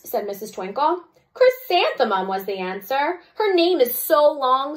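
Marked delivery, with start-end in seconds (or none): 1.3-2.3 high call. Acted. Answer given to teacher. 3.3-4.8 mocking. Jo mocks the name.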